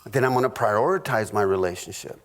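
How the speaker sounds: usual speaking voice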